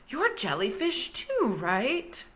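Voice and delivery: put-on happy young jellyfish; excited little voice. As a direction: bubble over with joy at new friends. Jerry excited to find jellyfish.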